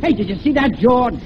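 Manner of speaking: high, irate Brooklyn yelling; Brooklyn accent. Acted. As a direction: yell at a driver in thick Brooklynese. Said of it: "talking with a Brooklyn accent".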